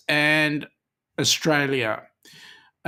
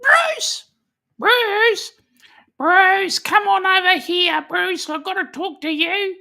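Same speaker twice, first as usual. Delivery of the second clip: high, loud shrill calls of Bruce; calling out; 5 dB louder than usual. Retold or imitated. Denise's chat message read as a high, loud call to Bruce. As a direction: yell across the room for Bruce, high and loud. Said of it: "Denise is saying".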